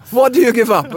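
high, loud incredulous question; baffled question. Retold or imitated. Told his partner gives up, loud baffled disbelief. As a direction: demand it in disbelief.

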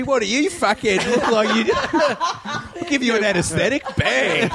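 high, slowed voice breaking into laughter; laughing mock surgeon. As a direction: ham up a violent surgeon. Surgeon beating patient, laughing.

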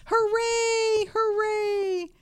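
very high, held cheer; jubilant cry. A narrator's reading. Clown and toys reaching the mountain top, jubilant cry. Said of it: "cried the funny little clown".

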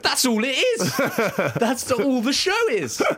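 high, indignant outburst imitating his co-host; mock angry. Imitating co-host angry at the Bayfield impressions idea, mock indignation. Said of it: "you got quite angry at that point. You were like".

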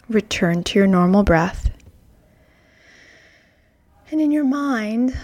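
usual speaking voice

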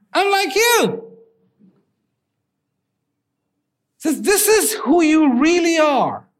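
slow and lifted, like a revelation; slow high reveal. Acted. Lion telling the cub who he really is, slow and high. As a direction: stretch it out, pitched high.

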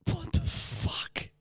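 high, slow whispered disbelief; stunned whisper. Real, not acted. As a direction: gasp it out in disbelief. Reacting again to the message.

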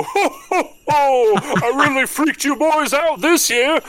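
very high, loud jolly character voice; belly-laugh it out with festive glee; hearty ho-ho-ho boom; Santa. Acted. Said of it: "you feel the arms of Santa go".

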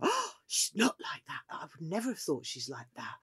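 an amazed diner confiding quietly; mock surprised customer. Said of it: "they said to Laura".

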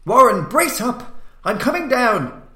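loud, high, slow determined shout; shouted resolution. A narrator's reading. Carter shouting down to his trapped friend, resolute shout. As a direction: yell down courage to him. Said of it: "I formed and shouted a resolution".

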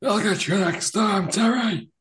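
high shouted villain threat; sputtering foiled villain. Acted. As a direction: shake your fist and yell it. Austin shaking his fist.

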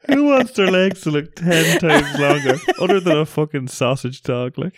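laughing incredulously. Real, not acted. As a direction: ask it while cracking up in disbelief. Cracking up at absurd claim.

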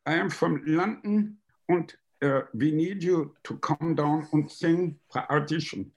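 a hint of a German secretary on the phone; German accent. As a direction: caller with a mild German accent. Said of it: "And she went".

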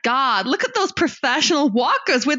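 hammed-up American, high and drawn out; American accent. Shown as a demonstration. Co-host retrying the tourists' remark, exaggerated American accent. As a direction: gush like an over-the-top American tourist. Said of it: "let me get into it".

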